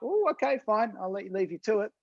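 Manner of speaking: high-pitched retreating "oh, okay"; backing off. Retold or imitated. Bystander to the screaming couple after they turn on him, backing off. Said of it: "I'm like".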